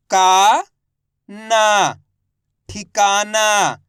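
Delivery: का and ना held long and loud, then a slow ठिकाना; syllable-by-syllable reading. Shown as a demonstration. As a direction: sound out ठिकाना for learners. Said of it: "एक अक्षर का उच्चारण करके इनको पढ़ रहा हूँ". (का, ना अलग-अलग, फिर ठिकाना धीरे, खींचकर)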